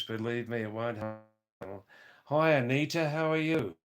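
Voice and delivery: normal talking voice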